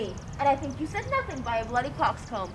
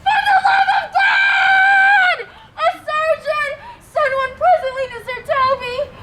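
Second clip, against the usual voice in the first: frantic cry for help; 10 semitones higher, 12 dB louder than usual. Acted. Injured knight calling help for Sir Toby, frantic shouting. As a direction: scream for a surgeon at the top of your voice. Wounded knight calls for surgeon.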